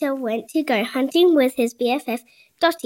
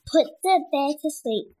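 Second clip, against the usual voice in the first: ordinary delivery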